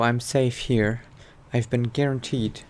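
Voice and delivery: ordinary talk, nothing marked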